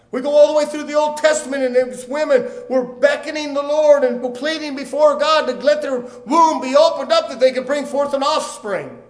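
high, loud, urgent pulpit cry; full-force preaching. Real, not acted. Preacher recounting barren women pleading before God, impassioned preaching.

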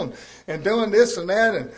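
ordinary delivery

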